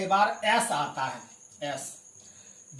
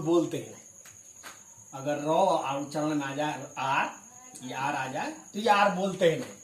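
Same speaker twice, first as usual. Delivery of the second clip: ordinary talk, nothing marked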